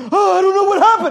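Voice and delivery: high, loud imitation of a bewildered church member; mock cluelessness. Pastor mimicking member whose grandma left offended, mock cluelessness. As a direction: play up the helpless bafflement, high-pitched.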